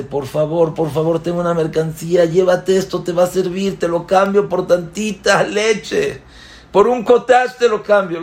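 eager merchant begging to trade, rising to a near cry; pleading trader. Acted. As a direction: plead desperately for a bit of milk. Trader begs him for milk.

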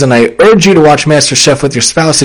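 ordinary talk, nothing marked

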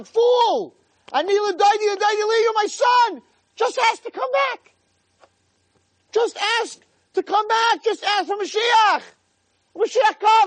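very high, anguished shouted plea; Hashem crying out. Acted. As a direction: wail and plead at the top of the voice. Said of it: "Hashem's crying".

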